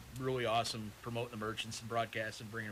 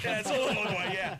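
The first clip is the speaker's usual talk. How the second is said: laughing; 8 semitones higher, 6 dB louder than usual. Real, not acted. Teasing echo of co-host's joke.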